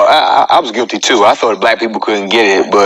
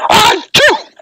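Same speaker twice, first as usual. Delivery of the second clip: mock sneeze. Comedian retelling his vacuuming sneeze, mock sneeze. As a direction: act out a big sudden sneeze.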